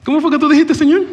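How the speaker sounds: high, loud exasperated cry; exasperated shout. Acted. Habakkuk crying out to the Lord, exasperated shout. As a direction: blurt it out in mock disbelief. Preacher voicing Habakkuk's frustration.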